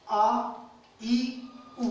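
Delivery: आ, ई, उ each held as a long vowel; long आ, long ई, long उ with pauses between. Shown as a demonstration. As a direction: say आ, ई, उ separately, drawn out as long vowels. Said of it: "बड़ी ई और बड़ा उ".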